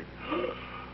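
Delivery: sharp unvoiced gasp of fright; mock frightened gasp. Shown as a demonstration. Teacher shows Bartolo's fright with a mock gasp. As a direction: gasp as if scared to death. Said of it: "the poor man at the end will say".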